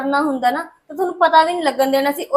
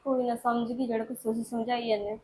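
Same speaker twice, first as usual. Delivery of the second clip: much softer, lower sulk; sullen resignation; 6 semitones lower, 12 dB quieter than usual. Acted. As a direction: give in bitterly.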